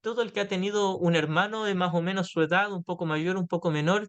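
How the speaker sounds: ordinary talk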